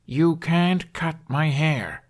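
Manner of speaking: slow, deliberate, spelled-out words; slow and loud. Acted. Hiccup to the aide who speaks no Norse, slow and deliberate. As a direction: talk slowly as if to a foreigner. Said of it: "Hiccup told the teen slowly".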